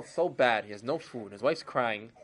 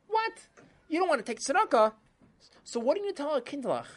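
much higher, loud disbelieving wife; shocked wife; 11 semitones higher, 4 dB louder than usual. Acted. Wife can't believe Chaim refuses tzedakah, high shocked voice. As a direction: gasp it out in disbelief.

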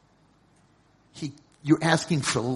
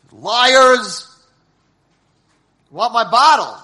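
put-on furious drunken yell; 8 semitones higher, 14 dB louder, slower than usual. A drunk man yelling at the staff who took his bottle, furious. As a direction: shout it high and loud, outraged.

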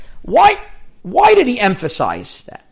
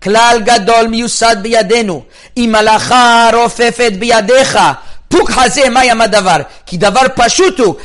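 fast, louder Hebrew text chant; reading Hebrew text aloud; 3 semitones higher, 4 dB louder, faster than usual. Shown as a demonstration. Rabbi reading the source to his students, brisk and loud. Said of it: "Hilchot Ktanot Siman Tet".

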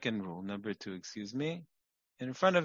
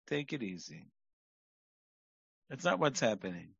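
ordinary delivery

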